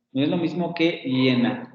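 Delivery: usual speaking voice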